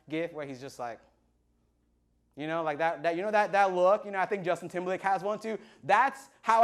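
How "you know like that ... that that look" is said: normal talking voice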